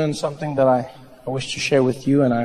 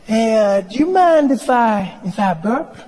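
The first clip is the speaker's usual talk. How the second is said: high, louder, hesitant put-on voice; wheedling body; 7 semitones higher, 4 dB louder than usual. Body asking comic for permission, high and wheedling. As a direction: ask permission innocently as your own body. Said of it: "my body was like".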